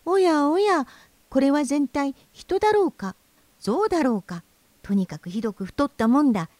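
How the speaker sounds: high, bird-like voice, a bit appalled; tiny high bird voice, half disgusted, half astonished; amazed thrush. A narrator's reading. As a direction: chirp it out like a small bird staring in disbelief. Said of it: "少し呆れたように言いました".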